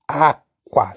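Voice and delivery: Acqua with a wide open first A, then a hard kw. Shown as a demonstration. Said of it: "with very open A, then the Q".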